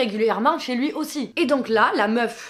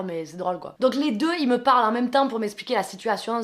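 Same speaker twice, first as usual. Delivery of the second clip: usual speaking voice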